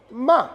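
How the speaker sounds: "ma" high, loud, dropping to an abrupt stop; falling fourth tone. Shown as a demonstration. Teacher showing M with A, "ma" in falling fourth tone. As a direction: throw "ma" down from high and cut it short. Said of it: "Le quatrième ton".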